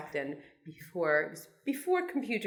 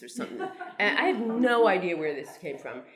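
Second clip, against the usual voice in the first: ordinary talk, nothing marked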